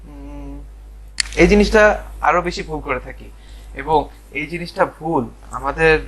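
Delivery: usual speaking voice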